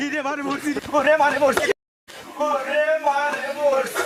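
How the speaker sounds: high, panicked wail, drawn out at the end; terrified cry. Acted. Walker crying out at a frightful sight, terrified. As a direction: cry out as if about to die.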